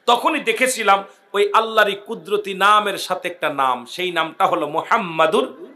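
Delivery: ordinary talk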